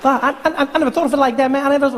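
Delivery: high, fast, breathless fanboy gush; squeaky rushed superfan rambling at his hero; young Obama voice. Acted. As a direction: pitch way up and rattle it off like an overexcited kid.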